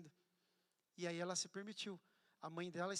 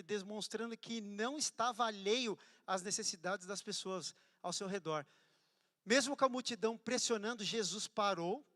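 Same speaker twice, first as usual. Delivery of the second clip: fervent preaching; 8 dB louder than usual. Acted. Pastor preaching to the church, loud and fervent. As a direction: preach it out over the crowd.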